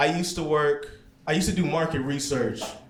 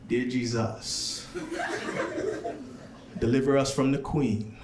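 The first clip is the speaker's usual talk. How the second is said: Jamaican prayer; 3 dB quieter than usual. Retold or imitated. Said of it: "A Jamaican prayer sounds like this".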